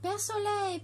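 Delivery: high, calling call to the sun; a mild call to Père Soleil. A narrator's reading. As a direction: call up to the sky. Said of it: "cria Perlette".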